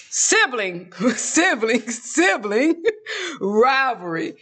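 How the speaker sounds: laughing between repeated tries; amused word fumble. Real, not acted. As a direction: crack up while retrying the tricky words. Tripping over a word, cracking up.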